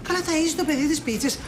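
normal talking voice

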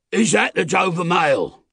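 high, loud call across a distance; calling from the mist. A narrator's reading. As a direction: hail the coach from afar. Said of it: "a man's voice called from the mist".

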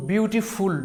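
বিউটিফুল slowed into two beats, বিউটি then ফুল; split into two syllables. Shown as a demonstration. Teacher showing learners two syllables in বিউটিফুল, split into two syllables. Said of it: "দুবার উচ্চারিত হয়েছে".

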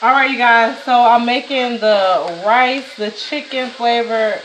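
usual speaking voice

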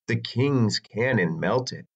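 ordinary delivery, nothing marked

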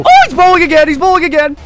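high-pitched warning, loud at first; sudden high-voiced game panic; alarmed. Real, not acted. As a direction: call out the attack in alarm. Boss attack starts again.

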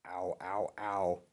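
whimpering in pain; mild cries of tummy pain. A narrator's reading. Stomach ache after banana.

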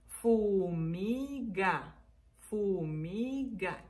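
"fumiga" stretched as fuu-mii-gaa; syllable by syllable. Shown as a demonstration. Teacher introducing three syllables, "fumiga" drawn out. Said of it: "una palabra de tres sílabas".

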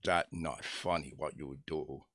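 slow, low mimicked warning; low, drawn-out imitation of an offended friend; King Wang. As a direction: imitate a broken-English rebuke, word by word. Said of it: "that's exactly how he said it".

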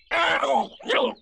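high, loud yelp of pain; Tigger. A narrator's reading. Tiger crunching thistles, loud pained yelps. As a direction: yelp with a prickled mouth. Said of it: "said Tigger".

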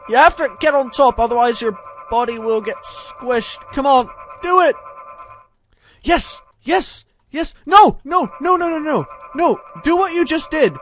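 high, loud, urgent shouting; frantic urging. Real, not acted. Gamer coaching the character over the obstacle, frantic urging. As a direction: yell panicked orders at the screen. Urges avatar through tricky move.

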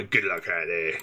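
low, plummy posh imitation. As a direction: lightly imitate a posh officer. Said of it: "Posh voice followed by".

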